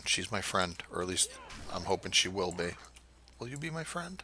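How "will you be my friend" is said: sweet pleading voice. Pretend. Asks a game character to be his friend.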